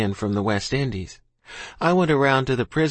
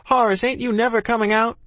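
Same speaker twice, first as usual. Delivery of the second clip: high, raised call across a distance; shouted; 10 semitones higher, 4 dB louder than usual. A narrator's reading. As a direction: call out loudly for a missing friend. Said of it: "raised up my head and shouted".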